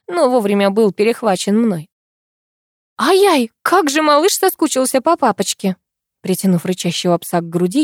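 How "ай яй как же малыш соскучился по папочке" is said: high, cooing puppy talk; giggly baby talk. A narrator's reading. Heroine coos to the growling dog, high and giggly. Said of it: "хихикнула я".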